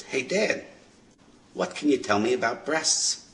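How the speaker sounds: a hint of a curious boy asking; young son. Young man asks his dad about breasts, lightly voiced son. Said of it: "this young man says to his dad".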